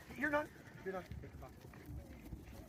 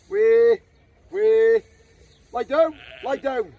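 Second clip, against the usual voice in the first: loud herding shout; 5 semitones higher, 13 dB louder, much slower than usual. Real, not acted. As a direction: bellow the commands across the field. Shouting commands to distant dog.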